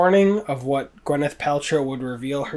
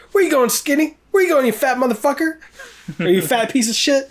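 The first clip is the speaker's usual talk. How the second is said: much higher, louder jeering; mock taunting yell; 11 semitones higher, 4 dB louder, faster than usual. Lincoln jeering at the fleeing audience member, yelling. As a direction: jeer after someone walking off. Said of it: "my favorite line is".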